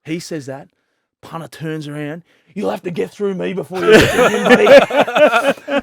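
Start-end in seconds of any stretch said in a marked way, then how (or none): 2.6-5.8 loud, high challenge half laughing through it; defiant threat. Retold or imitated. Captain standing up for his bowler, loud laughing threat. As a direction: square up and dare him, laughing. Punter confronting the opposing batsman.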